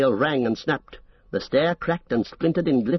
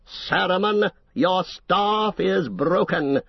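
slow, higher, icy pronouncement; clear, cold voice; 7 semitones higher, slower than usual. Acted. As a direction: pronounce it slowly and coldly. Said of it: "spoke slowly in a clear, cold voice".

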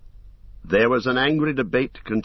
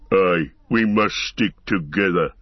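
low, deep growl of a brute orc; 3 semitones lower, 3 dB louder than usual. A narrator's reading. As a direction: growl it out heavy. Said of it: "growled Ugluk".

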